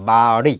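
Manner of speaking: বা রি as "baa-ṛi", tongue flapped on রি; r mixed with d. Shown as a demonstration. Teacher demonstrating doy bindu ro, বা রি with a flap. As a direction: split বা রি and blend d into the r. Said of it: "রর সাথে যখন তুমি ডটাকে mix করে".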